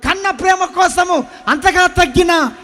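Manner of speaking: loud, slow, high proclamation; God's answer proclaimed. Retold or imitated. Preacher repeats what God told him: slow loud proclamation. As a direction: proclaim it slowly as a voice from heaven. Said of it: "ఇట్నే వినిపించిండు సరందారు నాకు".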